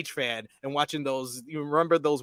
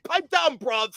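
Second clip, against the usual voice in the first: mock cockney warning; quick British menace, half-laughing; imitating a British threat; 7 semitones higher than usual. Quoting Ospreay's promo in a mock British accent. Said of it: "when he said".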